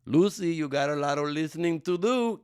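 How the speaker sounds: put-on loud, high Cuban accent as Ricky Ricardo; [name] impression. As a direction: belt it like [name] scolding Lucy. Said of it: "Can I still do that accent?".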